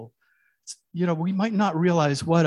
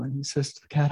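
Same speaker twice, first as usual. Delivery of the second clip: ordinary talk, nothing marked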